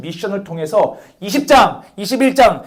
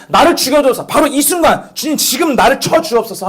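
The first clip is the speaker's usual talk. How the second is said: higher, pressing death plea; pleading prayer; 6 semitones higher than usual. Shown as a demonstration. Preacher praying to Sangjun as God, pleading. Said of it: "내가 너한테 기도하는 거야".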